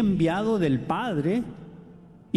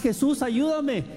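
mock-shouted plea, higher; retold plea for help; 8 semitones higher than usual. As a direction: cry out to him for help. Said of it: "No nomás".

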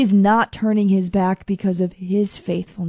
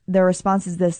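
ordinary delivery

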